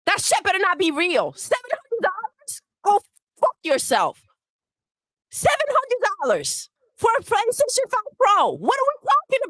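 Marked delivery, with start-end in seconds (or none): none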